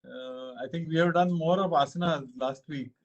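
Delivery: usual speaking voice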